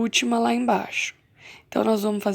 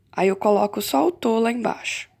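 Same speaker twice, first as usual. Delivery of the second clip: ordinary delivery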